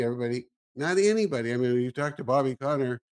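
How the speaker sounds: ordinary talk, nothing marked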